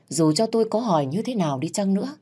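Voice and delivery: ordinary delivery, nothing marked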